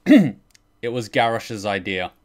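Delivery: ordinary talk, nothing marked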